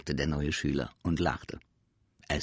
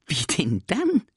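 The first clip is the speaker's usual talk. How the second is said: higher, louder, puzzled question; astonished; 10 semitones higher, 6 dB louder than usual. Acted. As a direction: ask it wide-eyed and baffled. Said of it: "fragte das Sams erstaunt".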